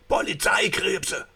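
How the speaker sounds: high, loud panicked shout; frightened warning shout; alarmed cry. Acted. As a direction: cry out in terror.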